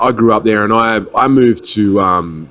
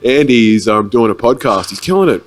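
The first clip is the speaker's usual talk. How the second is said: higher, louder mock hype for a local guy; excited hype; 5 semitones higher, 3 dB louder than usual. Sharing a friend's podcast on Facebook, mock hyped gushing. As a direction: gush about him like a fan, hamming it up. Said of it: "I'm just like".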